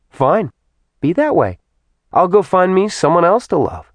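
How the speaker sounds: high, huffy woman's voice; acted airy defiance. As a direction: flounce off, pitch climbing with each phrase. Woman flounces off after being rebuffed.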